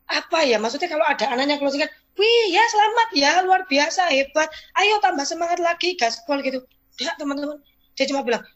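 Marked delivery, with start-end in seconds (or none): 2.1-6.5 pujian riang bernada tinggi; pujian heboh. Retold or imitated. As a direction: tiru leader lain yang antusias. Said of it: "bukan sing kayak leader". (leader lain memuji anak buah dengan heboh)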